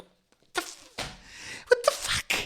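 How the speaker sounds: very high, half-voiced squeak of disbelief; baffled exasperation. Real, not acted. Ranter baffled by email retraction, squeaky exasperation. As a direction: let it squeak out high, without extra volume.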